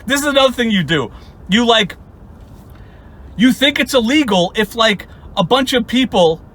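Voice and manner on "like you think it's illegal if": usual speaking voice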